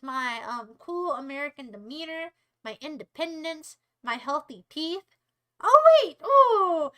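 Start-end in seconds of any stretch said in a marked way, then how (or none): 5.6-6.9 sudden high, loud alarm; surprised. Real, not acted. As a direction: caught out mid-insult, yelp it. British viewer just came in.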